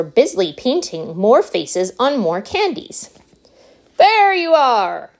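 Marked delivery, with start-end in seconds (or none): 4.0-5.1 high, loud, drawn-out announcement; exultant cry. A narrator's reading. Wonka presents his candies to the visitors, crying out proudly. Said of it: "cried Mister Wonka".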